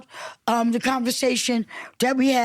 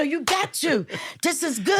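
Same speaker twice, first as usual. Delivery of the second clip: higher-pitched acted enthusiasm; excited; 4 semitones higher than usual. Speaker showing how contagious excitement sounds, excited. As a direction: act eager to win the listener over. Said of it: "if you don't get excited".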